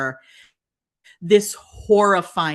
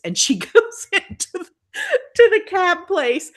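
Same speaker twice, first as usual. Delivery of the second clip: laughing; 11 semitones higher, 5 dB louder than usual. Real, not acted. Laughing while retelling.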